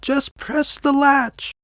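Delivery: put-on high, slow old woman's voice; a weak old granny calling from bed; frail grandmother. Grandmother calls from her sickbed to the door, high and slow. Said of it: "Grandmother replied".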